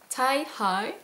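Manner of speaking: French speaker's go at English; self-mocked English accent; attempted English accent. Real, not acted. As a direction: stretch out the English name in a clumsy English accent. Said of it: "Super accent".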